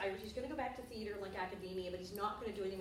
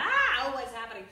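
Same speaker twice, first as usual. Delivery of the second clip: sudden loud startled "ah, oh", then settling; jolted by a surprise attack; startled yelp; 11 dB louder than usual. Retold or imitated. Fan throws soda at her head, startled loud cry.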